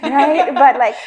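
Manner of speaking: laughing. Real, not acted. Laughing aside about pregnancy hormones.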